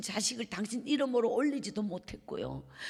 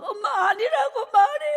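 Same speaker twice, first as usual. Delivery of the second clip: high, loud tearful begging, half laughing; retold sob of a child pleading with her mother; crying plea; 12 semitones higher, 10 dB louder than usual. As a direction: beg her through tears, voice cracking up high. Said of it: "막 엄마를 붙잡고 제가 울었어요".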